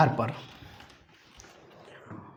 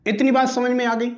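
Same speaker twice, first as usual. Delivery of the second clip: ordinary talk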